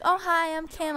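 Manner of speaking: high, chirpy hello; mock Kim's bright cheerfulness; imitating Kim's high chirpy hello; sweet greeting. Kim introduces herself sweetly.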